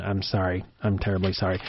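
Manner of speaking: quietly owning up to an awful joke; sheepish apology. Pretend. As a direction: drop your voice and apologize for the bad pun. Mock apology after a bad pun.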